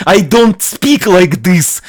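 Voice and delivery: slow, exaggerated Russian accent; movie Russian accent. Shown as a demonstration. Showing the commentator how he doesn't speak, hammed-up Russian accent. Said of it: "like this Russian guy from American movie".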